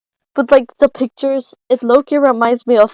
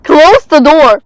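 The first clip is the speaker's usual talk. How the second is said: urgent shout; 3 semitones higher, 7 dB louder than usual. Real, not acted. Urgent during chase.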